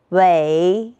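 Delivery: "微" as wěi, dipping low then rising; third-tone "微", long low dip; tone drill. Shown as a demonstration. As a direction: sink "微" low and lift it, third tone. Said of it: "我们一起读一读".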